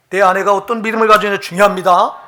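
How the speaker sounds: emphatic sermon climax; loud preaching. Real, not acted. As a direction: press the point home to the congregation. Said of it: "preacher building up".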